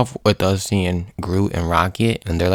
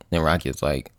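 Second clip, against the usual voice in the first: normal talking voice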